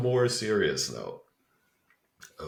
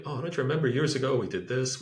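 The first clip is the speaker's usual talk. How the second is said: higher, louder mock reminder; imitating reminiscing people; 6 semitones higher, 5 dB louder than usual. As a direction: play someone nudging an old memory. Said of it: "I'll have people tell me".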